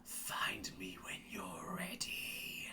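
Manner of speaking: slow, breathy hiss in the ear; hissed whisper. A narrator's reading. Dark warrior hissing into the player's ear, slow whisper. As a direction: hiss it slowly right into their ear. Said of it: "you hear a hiss in your ear".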